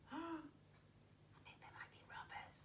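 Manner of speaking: slow hushed whisper. Acted. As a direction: whisper it with suspense. Teacher whispering suspense aside.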